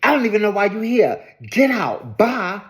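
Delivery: imitated indignant dismissal; retold blow-up. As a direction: act out someone suddenly set off, without shouting. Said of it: "I don't know what set Meredith off".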